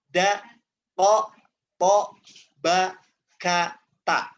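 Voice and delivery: tertekan, tekan setiap huruf; pressed letters. Shown as a demonstration. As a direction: hit every syllable hard and held. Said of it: "di sini dia suaranya tertekan".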